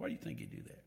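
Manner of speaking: whispered question. Acted.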